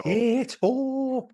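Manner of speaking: high, drawn-out Irish "Potato"; hammed-up Irish accent; mock Irish potato. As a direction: exaggerate the Irish vowels, pitched high and slow. Co-host joins the potato mimicry.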